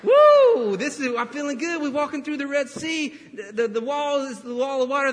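mock jubilant Israelites, pitched high; excited celebrating. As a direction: cheer like a crowd crossing the sea. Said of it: "They're like".

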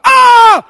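loud, high "Ah!"; exasperated yell. Real, not acted. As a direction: cut off the excuse with a shouted cry. Preacher's exasperated cry at excuse.

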